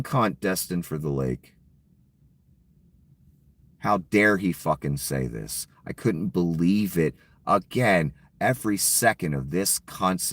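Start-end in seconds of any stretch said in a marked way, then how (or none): none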